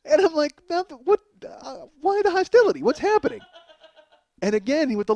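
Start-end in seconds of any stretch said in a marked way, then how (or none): 0.7-3.4 high-pitched baffled protest; bewildered. Acted. Comic answering the hostile stranger, bewildered. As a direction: sputter in hurt confusion.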